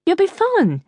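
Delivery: high, thick-tongued reassurance; swollen tongue. Acted. Mother telling Geoffrey to manage without banjo, swollen-tongued. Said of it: "your tongue is swollen".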